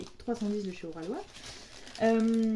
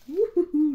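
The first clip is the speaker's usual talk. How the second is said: louder, higher "woo-hoo-hoo" cheer; excited whoop; 4 semitones higher, 6 dB louder, slower than usual. Real, not acted. Vlogger eager to try her diamonds, excited whoop.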